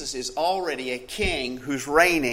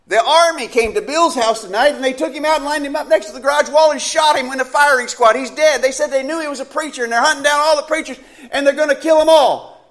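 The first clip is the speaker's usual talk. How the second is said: higher and louder, rushing; panicked report; 10 semitones higher, 9 dB louder than usual. Acted. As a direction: pour out the horror in fear. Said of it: "in a panic".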